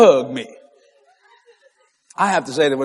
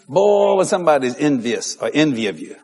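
ordinary talk, nothing marked

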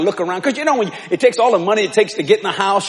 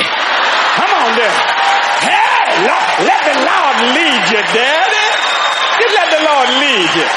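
shouted repeat; 3 semitones higher, 3 dB louder, slower than usual. Retold or imitated. Preacher repeats her line, shouting.

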